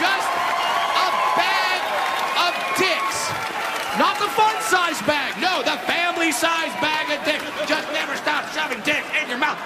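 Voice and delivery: high, loud furious rant; furious shout. Real, not acted. Comic rant at the offended crowd, shouted high and loud. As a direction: yell it at the people who take offence.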